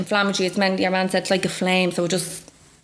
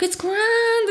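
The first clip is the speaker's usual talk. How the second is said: insisting. Retold or imitated. Insisting to the crying doctor.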